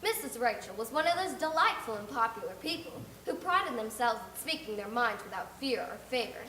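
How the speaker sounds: ordinary delivery, nothing marked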